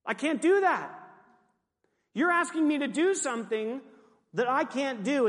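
high, emphatic objection; mock protest. Acting out Jeremiah's protest to God.

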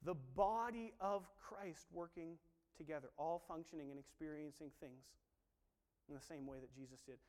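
usual speaking voice

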